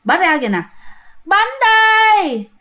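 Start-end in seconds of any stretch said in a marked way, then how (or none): 1.2-2.5 high, drawn-out hawker's call; long singsong vendor cry, pitched high; ghostly street vendor's cry. Retold or imitated. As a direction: call out cakes for sale, slow and eerie. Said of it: "Bà kêu vậy đó các bạn".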